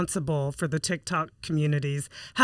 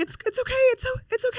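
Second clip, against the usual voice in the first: put-on tiny, very high child's voice; imitated hurt daughter; much faster than usual. Daughter reassuring guilty mom, imitated brave little voice. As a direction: squeak out brave reassurance, haltingly. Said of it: "She's like".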